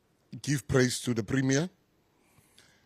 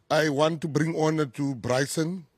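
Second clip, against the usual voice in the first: usual speaking voice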